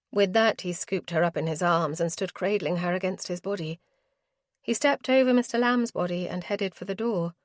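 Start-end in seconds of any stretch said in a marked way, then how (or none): none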